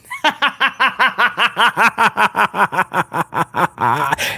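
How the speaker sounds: long, rhythmic descending cackle; villain laugh. Pretend. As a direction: laugh like the ultimate bad guy. Villain laugh after bad-guy boast.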